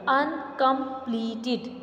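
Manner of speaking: "Uncompleted" stretched into four slow syllables. Shown as a demonstration. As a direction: sound out "Uncompleted" very slowly. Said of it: "we will pronounce the word".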